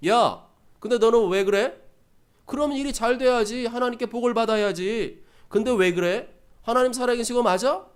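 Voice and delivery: loud, needling string of jeers; mocking taunts. People jeering at David over his God, mocking. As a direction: taunt him about his faith, pushing each point. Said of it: "이런 조롱하는 소리를".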